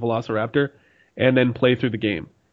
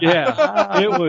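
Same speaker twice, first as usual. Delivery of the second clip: laughing; 10 semitones higher, 5 dB louder, much slower than usual. Real, not acted. Laughing at a bad game.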